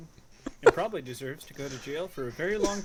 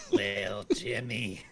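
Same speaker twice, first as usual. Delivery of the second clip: lower, slow drawn-out mocking voice; slow mock pity; 6 semitones lower, slower than usual. Player mocking the beaten-up clerk, slow low mock pity. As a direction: stretch it out, savouring the pity.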